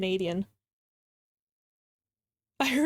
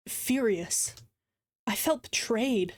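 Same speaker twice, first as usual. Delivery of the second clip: ordinary delivery, nothing marked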